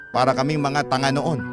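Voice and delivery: normal talking voice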